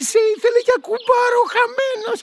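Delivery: high, fast scornful jab; fast high triumphant sneer; childish gloating. Acted. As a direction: taunt the dead man. Gloating over the dead guard.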